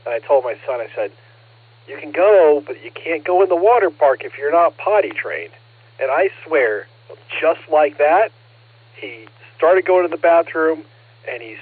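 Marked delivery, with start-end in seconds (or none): 1.9-5.5 a firm parent laying down the rule, a bit louder; stern warning to son. Retold or imitated. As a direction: raise the voice slightly, a parent's ultimatum. Telling son the water park rule.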